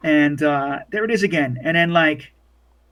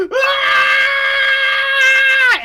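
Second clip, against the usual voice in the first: put-on piercing, drawn-out child shriek; very high-pitched sustained scream; long high scream; 6 dB louder than usual. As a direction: scream at the top of a child's range. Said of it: "you just hear this fucking scream".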